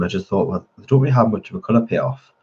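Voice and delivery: normal talking voice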